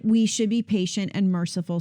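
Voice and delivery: usual speaking voice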